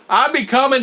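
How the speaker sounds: high, bright-eyed greeting burst; excited. Real, not acted. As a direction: read it out with a happy jolt. Excited reading of viewer comment.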